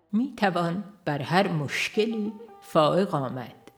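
ordinary talk, nothing marked

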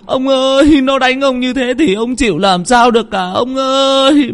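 high keening wail, slowing at the end; frantic lamenting wife. A narrator's reading. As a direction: wail in panic for your husband. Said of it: "Bà vừa xỏ dép vừa luống cuống".

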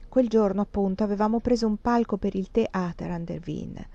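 ordinary delivery, nothing marked

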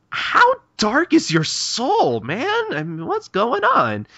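very high, drawn-out incredulous cry; appalled disbelief. Retold or imitated. Shinpachi reacts to Okita's dark confession, appalled disbelief. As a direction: stretch it out in hammed-up horror.

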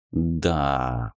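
low, soft gurgling slime; gloopy bubbling slime voice. A narrator's reading. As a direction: gurgle it like a blob. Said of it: "булькающий голос".

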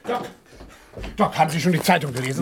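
rushed, worried calling out; agitated. Acted. Blessington bursting in on the doctor, rushed and agitated. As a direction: burst in hurried and alarmed. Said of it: "ziemlich aufgeregt zu mir in die Praxis herunterkam".

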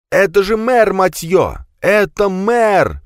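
high loud slow cry of shock; shocked outcry. Acted. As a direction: cry out in disbelief. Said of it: "вскричал Адамберг".

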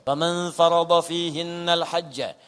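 slow, drawn-out verse chant; Quran recitation. Real, not acted. Preacher quoting hajj verse to congregation, slow recitation. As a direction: recite it slowly with sustained vowels.

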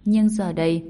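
usual speaking voice

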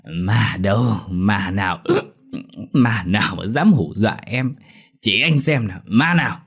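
put-on slurred drunken bluster. A narrator's reading. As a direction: slur like a drunk husband. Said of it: "lè nhè cái giọng".

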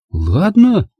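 put-on high, slow, stunned girl's voice; Angela's voice. A narrator's reading. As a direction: spell it out to him in disbelief. Angela agrees. (Анжела изумлённо настаивает, высоким голосом)

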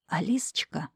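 conspiratorial stage whisper; breathy nosy granny whisper; old woman whispering. Acted. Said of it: "заговорщицким шёпотом". (заговорщицкий шёпот старушки)

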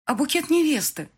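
high, startled question; alarmed bride. A narrator's reading. Bride missing her bouquet at the registry office, alarmed. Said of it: "всплеснула руками".

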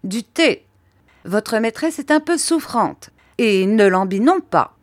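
brisk, high-pitched orders; energetic command. A narrator's reading. Miss Betsy orders tea from the servant, energetic. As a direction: order it with unquestioned authority. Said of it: "cet ordre avec autant d'énergie".

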